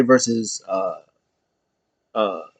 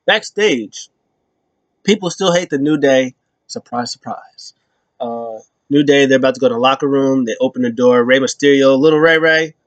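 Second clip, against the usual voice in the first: usual speaking voice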